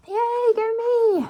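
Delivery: high, drawn-out cheer, slow; stretched triumphant "Yay, go me!" pitched high; big self cheer. Real, not acted. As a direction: celebrate yourself with a long high "Yay, go me!". Cheering a completed task.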